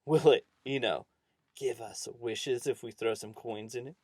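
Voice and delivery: loud, cheeky challenge to an elder; rude. A narrator's reading. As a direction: speak up boldly, rudely to the farmer. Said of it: "Stanley got all rude and spoke up".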